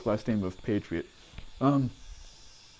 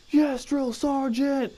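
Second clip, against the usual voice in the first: barked military recruit reply; 11 semitones higher, slower than usual. Retold or imitated. Recruit answering drill sergeant.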